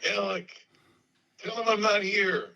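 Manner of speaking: high-pitched retold plea to cover for him; retold urgent plea. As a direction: imitate a friend begging to be covered.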